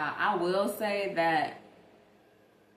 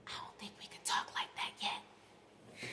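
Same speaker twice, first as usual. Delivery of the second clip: soft unvoiced whisper, much quieter; 12 dB quieter than usual. Real, not acted. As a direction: lean in and whisper it like a secret.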